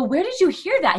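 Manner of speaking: high-pitched parental disbelief; re-enacted scandalized mom; mock shocked question. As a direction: ask the kid, playfully aghast. Acting out shocked question to son.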